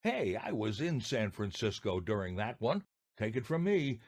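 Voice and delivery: ordinary talk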